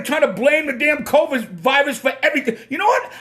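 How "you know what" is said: worked-up outburst. Real, not acted. Getting worked up about the shutdown.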